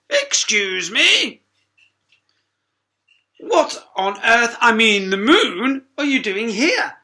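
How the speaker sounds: high, loud and slow in outrage; indignant hippo. Acted. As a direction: demand an answer. Hercules indignant.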